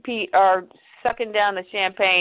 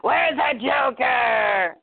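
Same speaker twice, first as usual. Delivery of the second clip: hammed-up dark-knight shout; gruff Batman voice; 2 semitones higher, much slower than usual. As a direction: growl it out slowly from under the cowl. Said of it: "puts a cowl over his face and goes".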